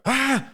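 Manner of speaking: short, high mock scream; startled shriek. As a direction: yelp as if someone burst in. Startled woman as player enters.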